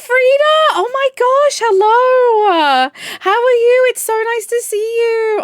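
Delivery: high, loud, overjoyed squeal of hello; excited emotional greeting. Real, not acted. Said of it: "I'm gonna actually start crying now".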